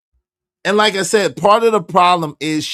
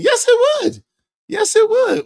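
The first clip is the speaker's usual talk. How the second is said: much higher, emphatic yes; emphatic agreement; 4 dB louder than usual. Real, not acted. Host agreeing with the husband's imagined words, very high. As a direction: agree with a squeal.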